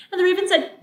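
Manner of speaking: ordinary delivery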